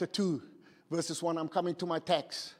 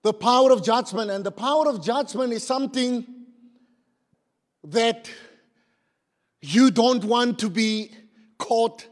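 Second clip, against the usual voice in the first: loud, raised proclamation; full-force preaching; 5 semitones higher, 9 dB louder than usual. Real, not acted. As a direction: ring out the theme to the congregation. Preacher announces theme at full force.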